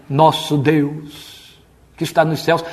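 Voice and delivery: very slow, drawn-out mock invocation; ridiculous prayer voice. Preacher mimicking how people pray, ponderous prayer voice. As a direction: stretch out a solemn opening to God. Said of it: "ridículo que a sua oração é".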